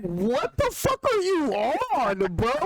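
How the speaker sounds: high, drawn-out disbelief; acted-out incredulous reaction, slow and high; retold incredulous outburst. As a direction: stretch it out slowly in high-pitched shock. Retells his stunned reaction to blade.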